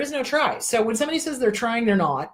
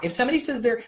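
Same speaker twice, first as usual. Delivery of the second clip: normal talking voice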